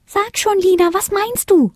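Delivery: very high, eager fairy voice; squeaky-high impatient prompting; little fairy urging. A narrator's reading. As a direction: press for an answer in a tiny high voice. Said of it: "forderte kleine Fee sie auf".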